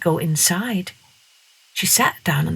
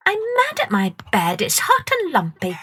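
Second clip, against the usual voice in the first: put-on much higher, cross child's voice; cross girl; 10 semitones higher than usual. A narrator's reading. Masha telling Peter Cockerel about her lumpy bed. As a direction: complain crossly as a little girl.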